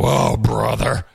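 mildly groaned exasperation, drawn out; slow mock groan of dismissal; imitated weary groan. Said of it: "They all, like, groan".

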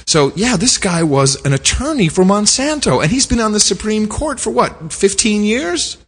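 high, scandalized summing up; rising disbelief, slowing at the end; incredulous. Real, not acted. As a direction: sound amazed, pitch climbing to the question.

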